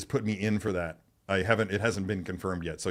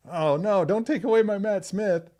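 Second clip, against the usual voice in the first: mock dismay; 10 semitones higher, 3 dB louder than usual. Mock dismay at losing Doctor.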